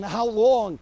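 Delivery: loud pained shout of how long; screamed cry. Acted. Habakkuk screams to heaven, loud cry. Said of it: "screams to heaven".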